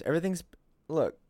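ordinary talk, nothing marked

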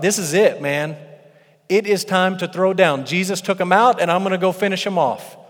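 rally yourself to charge in; fired-up Peter. Acted. Preacher voices Peter deciding to fight in the garden, fired up. Acting Peter's thought before attacking.

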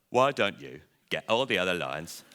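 a bird offering a helpful idea; crow's voice. Acted. Crow on Lion's nose suggesting an idea, in a lightly voiced crow. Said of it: "A crow landed on Lion's nose".

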